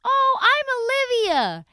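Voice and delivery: put-on high, loud little-girl voice, drawn out; Olivia. Little sister introduces herself, high loud imitated child voice. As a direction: sing it out slowly like a small child. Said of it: "to the sister".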